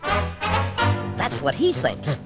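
slow, low scheming aside; a villain's secret gloat; sly aside. Acted. Daffy gloats to the audience, slow low aside.

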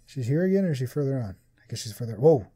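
ordinary talk, nothing marked

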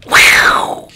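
loud snake hiss; drawn-out s like a snake. Pretend. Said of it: "Don't, don't shout as loud".

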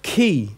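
chi spoken slow; teacher modelling chi like chemical; hard ch demo. Shown as a demonstration. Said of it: "as in chemical".

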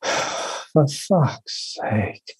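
sighed, very slow whispered curse; exasperated whispered curse. Shown as a demonstration. Topping off the sigh with a whispered curse. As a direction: breathe it out in weary disgust. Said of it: "at the end of the sigh, you go".

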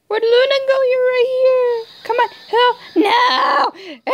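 high, loud, near-tearful calling; panicked crying shout. Real, not acted. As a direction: yell for help as the ally vanishes. Panicking over lost teammate.

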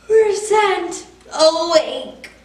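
lightly voiced pleading pigeon, wheedling and bargaining; a cheeky pigeon stalling bedtime. Pigeon begs the child to let him stay up, lightly pleading.

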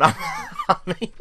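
talking through laughter; laughing. Real, not acted. Gamer getting a random bid while simulating the window, laughing. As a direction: tell it while cracking up.

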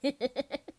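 high, loud giggle; loud amused laugh; teasing laugh. Acted. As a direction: burst out giggling at them. Laughs while teasing the other.

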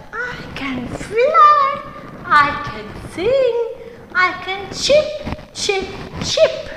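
high, bright-eyed little bird introducing itself; bird character voice. Acted.

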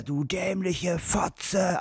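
put-on very slow, dragged-out drunk slur; mock drunk slur; slurred drunk insult. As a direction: drawl the insult like a wasted man. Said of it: "Und er dann wieder".

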